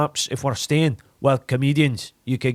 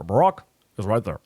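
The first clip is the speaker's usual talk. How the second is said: much lower, paused Obama delivery; Obama with pauses; 9 semitones lower, faster than usual. Shown as a demonstration. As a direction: pause before the words like Obama. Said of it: "And you add pauses".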